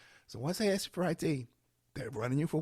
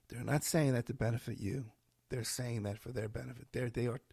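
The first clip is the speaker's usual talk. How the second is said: normal talking voice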